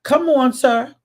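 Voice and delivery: ordinary talk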